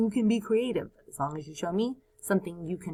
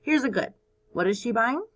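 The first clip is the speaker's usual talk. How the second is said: ordinary talk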